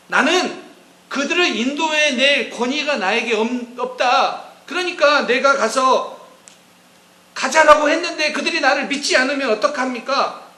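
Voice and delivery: loud protesting Moses. Retold or imitated. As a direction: cry out the excuse as if to God. Moses' third refusal voiced.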